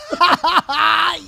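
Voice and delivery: high, loud cackling laugh; loud burst of laughter. Real, not acted. Host cracking up at guest's story, loud laughter. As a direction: crack up loudly.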